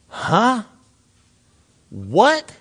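small questions swooping upward; baffled disbelief. Acted. Listener hears God's baffling plan, bewildered rising questions. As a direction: react dumbfounded, with pauses between. Acts bewildered reply to God.

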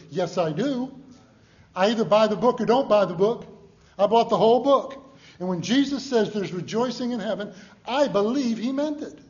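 loud, rising preacher's conviction; fervent preaching. Acted. Preacher builds to full force.

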